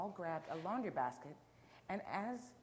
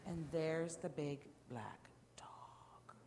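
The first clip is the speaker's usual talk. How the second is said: slow, whispery wonder; hushed reveal; 3 dB quieter, much slower than usual. Real, not acted. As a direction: draw it out in a whisper. Hushed aside revealing the picture.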